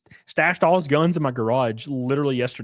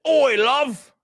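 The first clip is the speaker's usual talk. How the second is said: high-pitched mock British; hammed-up British voice, pitched well up; British accent; 8 semitones higher, much slower than usual. Shown as a demonstration. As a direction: put on a cartoonish British accent. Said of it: "Can you do a British accent?".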